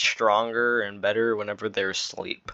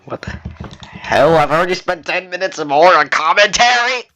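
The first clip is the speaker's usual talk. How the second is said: louder and higher, fed up with how long this is taking; exasperated outburst; 8 semitones higher, 9 dB louder than usual. Real, not acted. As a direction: blurt it out in frustration. Exasperated about long commentary.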